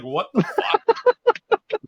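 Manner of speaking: high, very slow, drawn-out "What the fuck?"; stunned disbelief. Retold or imitated. Retelling being handed a free gift card, drawn-out stunned "What the fuck?".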